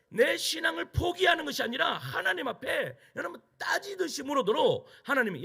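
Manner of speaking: high, loud preaching cry; preaching shout. Real, not acted. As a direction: cry it out to the congregation, pitch up. Preacher exhorts at full force. (높고 크게 외치는 설교)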